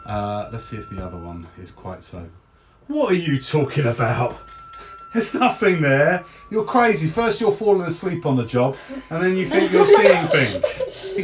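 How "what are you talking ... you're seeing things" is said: high, loud, dismissive guard; exasperated guard voice, pitched up; scornful second guard. Acted. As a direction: tell off a jumpy colleague. Second guard scolds the first.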